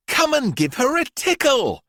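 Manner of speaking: put-on high, loud coaxing little-girl voice; encouraging Miranda. A narrator's reading. Miranda urging scared children to tickle her snake, coaxing. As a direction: coax the others to come closer. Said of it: "encouraged Miranda".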